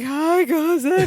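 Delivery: high, drawn-out mock sobbing; through sobs. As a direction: imitate greeting someone while wailing. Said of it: "He greets everyone through his sobs".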